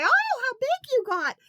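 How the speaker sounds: high, gushing grandma voice; doting grandma. Retold or imitated. Grandma gushing over the boys, high and cooing. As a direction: coo over the kids like a grandma. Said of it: "grandmas ... say".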